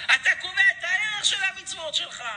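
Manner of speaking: high and loud preacher's peak; preaching at full force. Acted. Preacher hammering home his lesson to listeners, loud. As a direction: drive the point home. Sermon climax.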